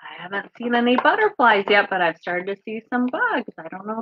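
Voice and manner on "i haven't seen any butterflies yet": ordinary delivery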